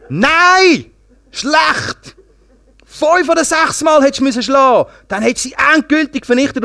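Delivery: high, loud scolding outburst; angry rebuke. Acted. Prophet scolds the man who struck only three times, high and indignant. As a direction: cry out in acted indignation.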